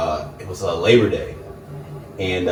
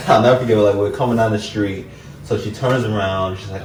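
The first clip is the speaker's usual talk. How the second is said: ordinary delivery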